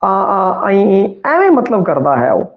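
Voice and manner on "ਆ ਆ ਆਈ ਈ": lightly imitating a small child's first sounds; a hint of baby babbling; baby babble. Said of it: "ਬੱਚਾ ਛੋਟਾ ਹੁੰਦਾ ਹੈ, ਬੋਲਦਾ ਹੈ".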